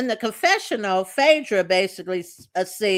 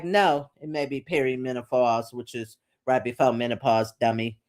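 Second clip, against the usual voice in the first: mock condescending put-down, much lower; condescending correction; 9 semitones lower than usual. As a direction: lower the voice, talk down to her. [name] condescendingly correcting Pinky.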